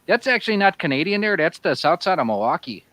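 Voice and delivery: put-on thick Milwaukee south-side accent with "there" and "eh"; Wisconsin accent. [name] riffing on Canadians for friends, Wisconsin accent. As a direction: lay on the upper-Midwest Wisconsin twang. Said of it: "that's sounds more like".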